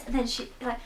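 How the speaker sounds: usual speaking voice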